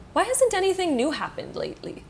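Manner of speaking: high-pitched puzzled question; play-acted bewildered musing; acted wondering. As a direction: wonder aloud, starting high in mock puzzlement. Voices someone housebound wondering about life.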